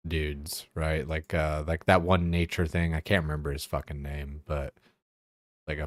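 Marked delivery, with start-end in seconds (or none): none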